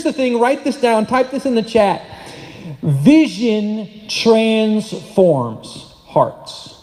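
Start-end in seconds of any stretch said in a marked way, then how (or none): 2.8-6.7 very slow, word by word; slow dictation. Real, not acted. Preacher giving the congregation a line to write down, very slow dictation. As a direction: spell out the key line with long gaps between words. Key line given for writing down.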